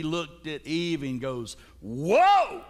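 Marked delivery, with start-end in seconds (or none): 1.8-2.6 mock amazement, loud swooping high; hammed-up stunned admiration, each word drawn out; awestruck wow. Acts out Adam seeing Eve.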